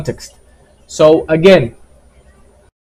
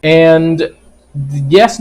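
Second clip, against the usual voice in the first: ordinary talk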